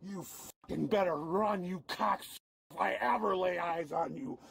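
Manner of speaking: ordinary talk, nothing marked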